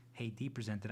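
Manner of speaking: ordinary talk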